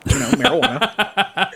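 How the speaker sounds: high, drawn-out giggly word; laughing. Real, not acted. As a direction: say it breaking into a laugh. Reading thread, laughing.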